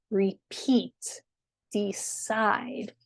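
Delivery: rePEAT, deCIDE; light first beat, long second in "Repeat" and "decide"; stress on second syllable. Shown as a demonstration. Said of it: "put the stress on the second syllable".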